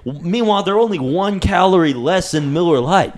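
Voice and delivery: hammed-up beer ad pitch; mock ad read. Hosts jokingly plugging their sponsor, mock ad read. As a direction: sell it like a beer commercial.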